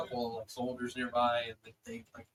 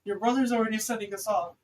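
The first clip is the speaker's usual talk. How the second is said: higher, louder motherly begging; worried mom making an emotional appeal; distraught mother pleading; 9 semitones higher, 5 dB louder than usual. Acted. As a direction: implore your child, pitched up and urgent. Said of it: "you see your mother, her hair all undone and crazy".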